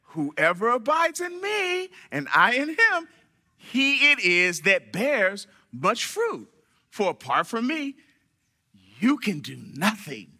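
drawn-out, over-patient verse reading; talking down slowly. Acted. Reading the verse as to a slow cousin, slow and patronizing. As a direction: spell it out as if to someone slow. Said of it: "Why they talk to me like I'm slow?".

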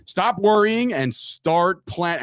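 very slow, stretched-out ad line; really slow read. Shown as a demonstration. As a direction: drag out every word on purpose. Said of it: "I'm gonna read this really slow".

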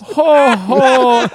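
loud, high-pitched mock Santa laugh; Minnesota Santa. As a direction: boom it out like a Midwestern Santa. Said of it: "Minnesota Santa".

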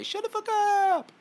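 high, stretched-out yelling. Shown as a demonstration. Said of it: "Give them the Juicy J".

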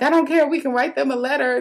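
high, insistent retelling; serious insistence. Retold or imitated. Sister insisting she leave work, mock-serious insistence. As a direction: imitate a sister who won't take no. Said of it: "but she was serious".

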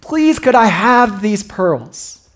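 put-on high, pleading little girl voice; begging child. Preacher voicing a little girl begging mama for pearls. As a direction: plead like a small child begging her mother. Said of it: "and begged her".

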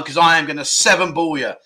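ordinary talk

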